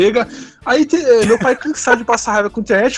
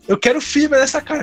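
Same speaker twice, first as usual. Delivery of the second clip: put-on thick Spanish accent, pitched up; heavy Spanish accent Portuguese; 7 semitones higher than usual. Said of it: "aquele sotaque espanhol do meu pai carregado".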